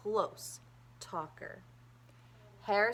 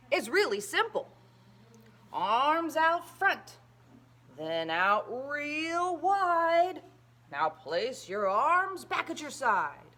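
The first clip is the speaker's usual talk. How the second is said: dad teaching; 6 semitones higher, 5 dB louder, slower than usual. A narrator's reading. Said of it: "his dad said".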